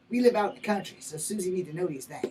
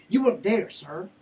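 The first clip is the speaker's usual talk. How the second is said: loud, raised-pitch rebuke; angry challenge; 4 dB louder than usual. Acted. Defendant confronting the man who judges him, angry challenge.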